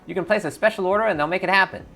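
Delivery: high, loud street-hustler voice; twitchy orc clocker. Acted. Twitchy orc clocker greeting the three runners, high and loud. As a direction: greet them like a jittery street dealer. Clocker pitches snuff and lab.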